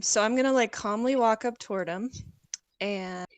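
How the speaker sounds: normal talking voice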